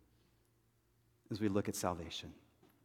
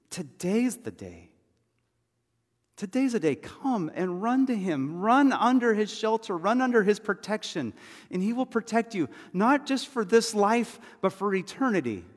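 high, loud altar call; impassioned preacher's plea at full pitch; urgent preaching; 11 semitones higher, 7 dB louder than usual. Real, not acted. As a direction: urge them to run, voice raised and insistent.

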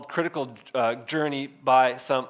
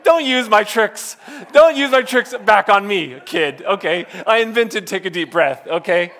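higher, louder, slowed mock protest; mock indignant; 4 semitones higher, 10 dB louder than usual. Dad to toddler son who used his calming trick, mock-outraged. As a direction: playfully scold the kid for stealing your line. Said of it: "And I was like".

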